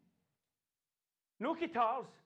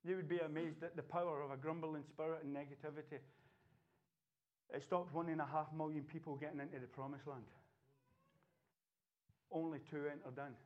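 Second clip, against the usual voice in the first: low, much softer sermon close; hushed preaching; 11 semitones lower, 8 dB quieter than usual. Real, not acted. Preacher warning the church in a low, hushed voice. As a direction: drop the voice down and press the warning in.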